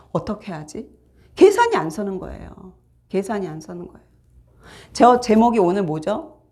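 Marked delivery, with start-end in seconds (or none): 3.1-4.1 계산이 안 서는 거예요 repeated much softer, dropping away; quiet echo. Shown as a demonstration. Preacher echoing his point to the congregation, soft repeat. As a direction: let the repeat fall to a quiet murmur. Repeats line, then softly.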